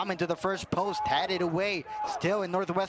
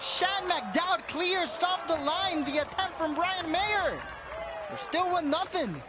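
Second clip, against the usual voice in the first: excited call; 10 semitones higher than usual. Real, not acted. Goal-line clearance called out.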